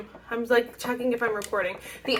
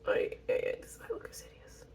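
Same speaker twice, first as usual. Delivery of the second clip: barely audible whisper to yourself; whispered dismay; 13 dB quieter than usual. Real, not acted. Vlogger despairing over a hideous eye look, whispered dismay. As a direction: whisper it under your breath in exasperation.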